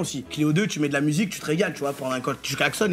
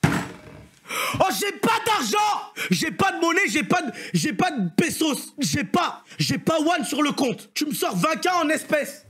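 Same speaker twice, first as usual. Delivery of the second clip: high-pitched disbelieving yell, laughing in spots; shocked outcry; 10 semitones higher than usual. Retold or imitated. Reacting to the dealer asking for twenty K in cash, shocked shout. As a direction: cry out in comic panic at the cash demand.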